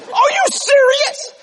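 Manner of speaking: put-on high, slow, amazed girl's voice; mock amazed girl asking are you serious; imitated waitress. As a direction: ham up the stunned young waitress. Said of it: "She said".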